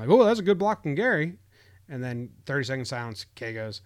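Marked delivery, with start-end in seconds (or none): 0.1-1.4 imitating a snide broadcaster, pitched up and louder; announcer faking praise as a question; mock questioning tone. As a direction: lift it into a doubtful question, mocking Kay. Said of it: "he said it as a question".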